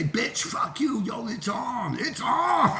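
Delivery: mock threat. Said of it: "Next day".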